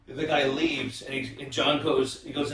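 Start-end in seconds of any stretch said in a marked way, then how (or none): none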